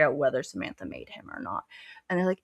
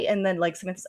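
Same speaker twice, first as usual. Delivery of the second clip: ordinary delivery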